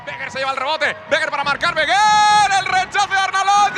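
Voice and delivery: fast, rising goal call; live play-by-play. Acted. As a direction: call the chance as it happens.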